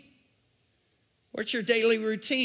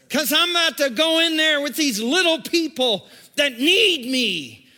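loud, higher pleading prayer; fervent prayer; 5 semitones higher, 6 dB louder than usual. Retold or imitated. A parent praying in the driveway, loud fervent plea. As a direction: preach it as a desperate prayer.

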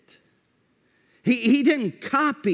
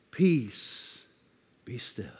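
very soft and low, almost under the breath; calm, hushed command; 8 semitones lower, 8 dB quieter, slower than usual. Acted. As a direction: let it drop gently to a hush. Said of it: "but you just".

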